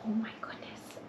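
ordinary delivery, nothing marked

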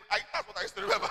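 usual speaking voice

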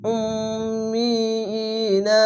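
উম্মিইনা with the ম্ম held as a long nasal hum, slow; nasal ghunna. Shown as a demonstration. Teacher showing ghunna to students, slow. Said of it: "এখানে ওয়াজিব গুন্না".

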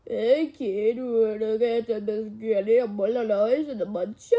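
low put-on stranger voice; mimicked voice. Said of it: "why do you copy sounds?".

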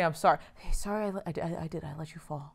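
low, very soft stammered apology; halting, hushed admission; reluctant apology. Acted. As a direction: mumble a sorry under your breath. Acting out a god's reluctant apology.

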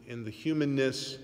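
ordinary talk

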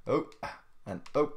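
usual speaking voice

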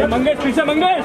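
high, loud call to a colleague. Real, not acted. Telling a colleague to get back, calling out. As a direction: holler to someone by name.

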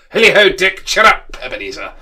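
high, booming, jovial holler; Fezziwig. A narrator's reading. Fezziwig rousing Dick and Ebenezer, loud and jolly. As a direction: holler cheerily, dropping on the last name. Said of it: "cried old Fezziwig".

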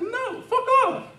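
a squeaky, exasperated no; mock Jesus refusal.

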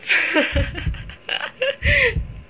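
high, trailing burst of giggles. Real, not acted. Gamer cracks up at talking to viewers, high giggle. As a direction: giggle helplessly at your own remark. Laughs at her own remark.

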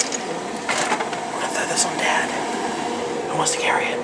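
whispering. Real, not acted. Plotting to dump ice.

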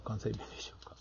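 breathy, near-silent joking murmur; whispered aside. Real, not acted. Whispering a joke to the viewers about Kansai dialect. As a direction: let it slip out under the breath.

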